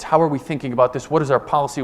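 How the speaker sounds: usual speaking voice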